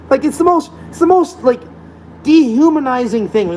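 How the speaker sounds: high, strained disbelief; exasperated. Real, not acted. Ranting about masks, high and exasperated. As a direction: voice climbing in outrage.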